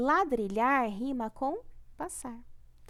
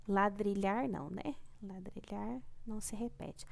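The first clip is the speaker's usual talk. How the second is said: whispered aside; 4 semitones lower, 7 dB quieter than usual. Real, not acted. Aside while checking repeated words.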